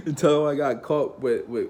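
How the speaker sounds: loud laugh running into the words. Real, not acted. Student telling friends to spread a rumour, laughing. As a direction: crack up at your own prank.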